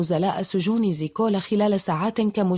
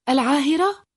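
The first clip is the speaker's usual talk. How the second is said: startled question, pitch climbing high; high, incredulous exclamation; raised voice; 7 semitones higher, 3 dB louder than usual. A narrator's reading. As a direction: blurt it out with a steep rising tune. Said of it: "فقال بصوت عال".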